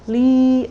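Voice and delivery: Li held high and loud; high level tone. Shown as a demonstration. Teacher showing Li in the first tone. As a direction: hit Li on a high flat tone. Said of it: "Li is for first tone".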